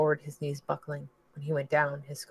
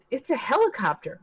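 excited cry; 9 semitones higher, 5 dB louder than usual. Acted. Sudden discovery in storm.

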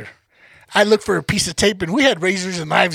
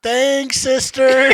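higher, louder careful thanks; timid thanks; 5 semitones higher, 5 dB louder, much slower than usual. Retold or imitated. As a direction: tiptoe in for a hug. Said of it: "You'd be like".

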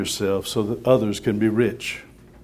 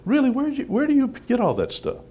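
much higher, mock disbelief; incredulous; 13 semitones higher, faster than usual. Real, not acted. Preacher challenging listeners' excuses, incredulous and high. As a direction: ask it like you can't believe your ears.

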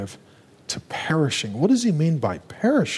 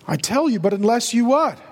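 pressing question; 8 semitones higher, 3 dB louder than usual. Real, not acted. Preacher pressing congregation for answer.

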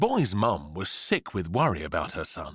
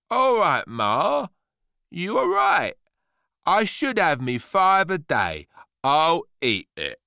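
loud, higher, put-on agreeable kid; scheming boy; 7 semitones higher, 6 dB louder than usual. A narrator's reading. Boy with wicked plan humours mum, showy agreement. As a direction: give in with deliberate, showy obedience.

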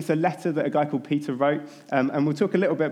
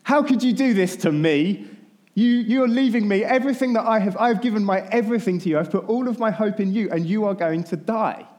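high, loud, hurt pleading; loud, high, wounded reproach to a friend; anguished plea; 6 semitones higher, 4 dB louder than usual. Retold or imitated. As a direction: cry out feeling abandoned. Peter's anguished plea to Jesus.

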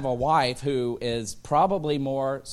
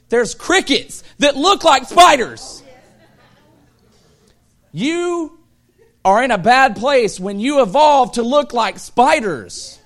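loud, high, laughing rant; loud comic outrage about spiders; laughing disgust; 11 semitones higher, 12 dB louder, slower than usual. Real, not acted. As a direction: build into mock horror at the bugs. Disgust rant about cave crickets.

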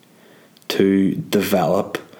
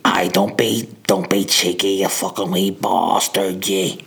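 abusive Northern Irish local. Acted. Local telling off someone cheeky, mock-threatening put-on voice. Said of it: "He'd be like".

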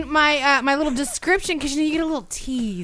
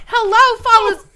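loud; 10 semitones higher, 9 dB louder than usual. Real, not acted. Exclaims at pumpkin pie idea.

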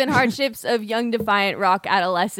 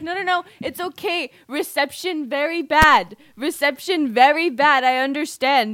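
put-on Indian accent; 4 semitones higher, 6 dB louder than usual. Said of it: "fake the Indian accent".

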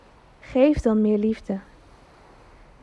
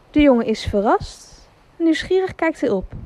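usual speaking voice

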